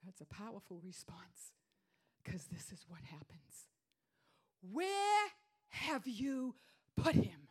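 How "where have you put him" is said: slow, loud and high, a command; commanding Jesus. Shown as a demonstration. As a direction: order it with full authority. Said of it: "It wasn't a question".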